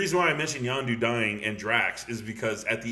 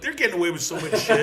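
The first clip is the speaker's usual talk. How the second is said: laughing; 9 semitones higher, 6 dB louder, faster than usual. Real, not acted. Laughing about the movie.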